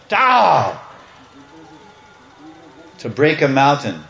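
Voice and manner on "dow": high, falling "dow" blast; vocal sound effect of a thunderbolt; imitated lightning bolt. Storyteller showing Indra's lightning strike, imitated blast. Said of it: "throws a lightning bolt".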